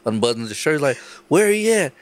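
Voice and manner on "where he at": high-pitched, riled-up challenge; mock fighter demanding a showdown; confrontational retelling. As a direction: call them out, voice pitched up. Guest storms out looking for someone.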